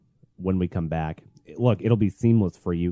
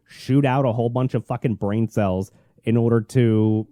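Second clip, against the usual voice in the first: ordinary delivery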